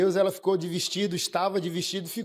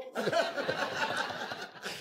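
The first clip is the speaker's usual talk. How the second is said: stifled laugh; 8 semitones higher, 8 dB quieter than usual. Real, not acted. Officer laughing at pastor.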